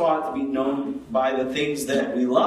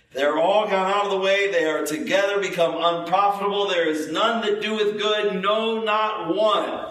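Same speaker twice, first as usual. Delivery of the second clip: higher-pitched, ringing scripture quotation; scripture recitation; 4 semitones higher than usual. Acted. Preacher citing Romans as someone's objection, scripture recitation. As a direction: recite the verse on a raised, sustained pitch.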